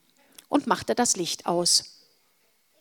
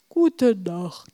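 sleepy; 3 dB louder than usual. Acted. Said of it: "sagte Wilma so verschlafen".

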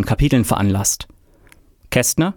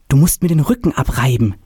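ordinary delivery

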